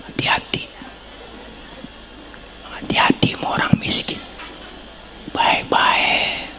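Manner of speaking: hushed warning whisper. Acted. Preacher whispering a warning to the congregation about the poor. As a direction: lean in and breathe it like a secret.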